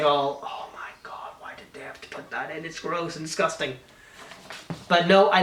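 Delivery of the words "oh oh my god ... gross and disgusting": disgusted whisper. Retold or imitated. Mock reaction to cringe humour.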